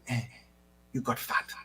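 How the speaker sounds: soft, understated mock-mom remark; mom's quiet aside. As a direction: drop to a near-whisper, as a retold aside. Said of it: "she looks at you and says".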